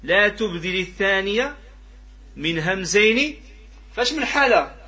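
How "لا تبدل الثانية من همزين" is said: slow, measured verse with stretched vowels; verse recitation. Acted. Teacher quoting the poem for the class, verse recitation. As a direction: recite the line slowly, a little raised. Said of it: "لذلك قال الناظم".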